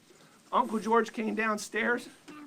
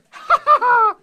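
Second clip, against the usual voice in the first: loud burst of laughter; big laugh; 15 dB louder than usual. Real, not acted. Laugh after the tempting speech.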